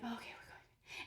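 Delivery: soft unvoiced whisper of relief. Watching the wing screw, whispered relief. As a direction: breathe it out in a whisper.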